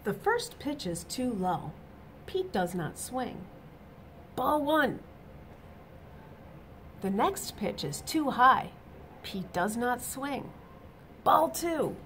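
bright, lively storybook reading; reading to children. A narrator's reading. Teacher reading a picture book to children, animated. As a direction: read it out to the little ones with energy.

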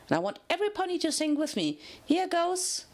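high, fast, eager; excited. A narrator's reading. Pinky rallies the prisoners to sing along, high and eager. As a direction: rush it out, bursting to sing.

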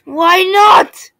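high, loud protest; loud exasperated. Real, not acted. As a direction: complain loudly at the game. Game piece won't fit.

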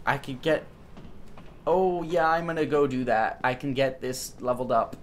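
ordinary delivery

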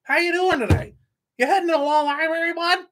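high, loud sing-song mock of a prison dude; mocking inmate greeting. Mocking fellow inmates to the audience, high fake-friendly voice. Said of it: "They're like".

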